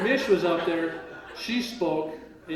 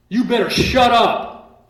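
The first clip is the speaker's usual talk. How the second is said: slow loud shout, pitched higher; imitated yell; 7 semitones higher, 11 dB louder, much slower than usual. Judge yelling at the defendant to shut up, loud shout. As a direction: bark it out slowly like an angry judge.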